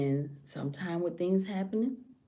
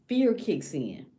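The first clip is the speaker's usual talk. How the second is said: usual speaking voice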